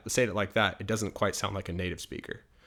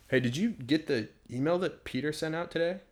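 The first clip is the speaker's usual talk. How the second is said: coworker asking; 6 semitones higher than usual. Acted. Acted office dialogue.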